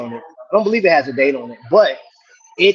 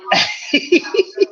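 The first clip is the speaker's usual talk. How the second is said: high wheezy giggle; high, breathless laugh; real laughter; 14 semitones higher than usual. Real, not acted. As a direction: crack up in a quick burst. Amused by viewer's KFC comment.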